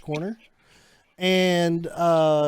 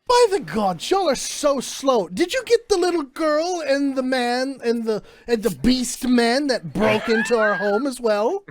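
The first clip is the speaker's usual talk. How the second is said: higher, imperious scolding; haughty noblewoman; 6 semitones higher, slower than usual. Acted. Noble lady scolding the slow City Watch guard, haughty and high.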